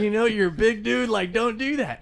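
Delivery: high, loud, still laughing; amused scolding. Retold or imitated. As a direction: playfully talk a big guy down. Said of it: "It was like".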